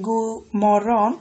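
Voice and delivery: slow, deliberate গু মরন with no d after গু; silent d in গু. Shown as a demonstration. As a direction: stretch out গু মরন, leaving out the d. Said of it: "ডি উচ্চারণ করা হয় না". (ধীরে গু মরন, ড ছাড়া)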